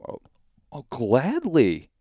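drawn-out, high mock-eager gladly; slow sarcastic delight. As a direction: overplay the willingness, dripping sarcasm. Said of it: "And I'm like".

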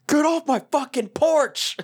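high, drawn-out mock yell; slow, high-pitched squawk of mock outrage; retold yelling. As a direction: imitate an outraged yell from the background. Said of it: "yelling at him".

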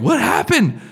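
high, urgent mock question; frazzled alarm. Wife and kids arriving at the ER, frazzled alarm. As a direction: imitate a worried family rushing in. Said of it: "they look all frazzled".

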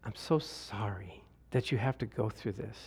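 soft, low mock pity; pitying lady. Pastor imitating a pitying lady, hushed and low. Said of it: "the one lady says".

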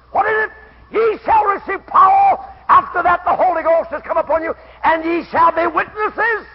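loud, high declaiming of scripture; preaching at full force. Real, not acted. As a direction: thunder the verse at the congregation.

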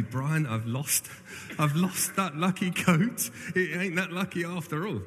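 high, drawn-out mock plea; mock confession. Vicar admitting to his friend the lucky coat is lost, high mock confession. Imagined confession he couldn't make.

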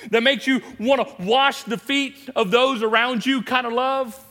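loud, high, rising preacher's build; preaching at full force. Acted. Preacher lifting the congregation on love.

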